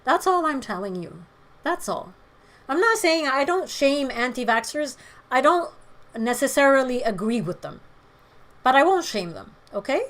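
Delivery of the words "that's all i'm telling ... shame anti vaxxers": normal talking voice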